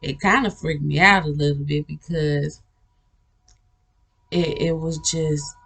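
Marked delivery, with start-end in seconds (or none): none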